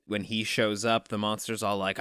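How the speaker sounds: ordinary delivery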